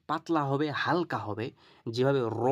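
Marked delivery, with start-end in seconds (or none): 2.4-2.4 র as a long, heavy "ro"; thick rounded ra. Shown as a demonstration. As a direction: round the mouth and hold র. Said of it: "মোটা করে গোল করে পড়ছি".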